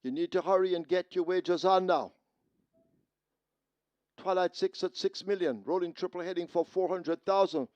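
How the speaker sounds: loud, high PA call; urgent call over the track speakers; through loud speakers. Real, not acted.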